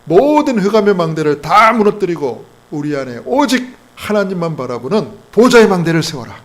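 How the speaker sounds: loud, emphatic exhortation; forceful preaching. Real, not acted.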